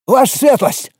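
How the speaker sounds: high urgent exclamation; exclaiming. Acted. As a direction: burst in calling out. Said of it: "воскликнул вбежавший стражник".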